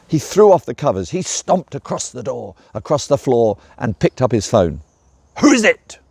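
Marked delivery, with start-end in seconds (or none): 5.4-6.0 loud, higher angry shout. A narrator's reading. Grumpy dragon shouting down the phone at the caller. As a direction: yell it down the phone. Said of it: "he shouted down the line".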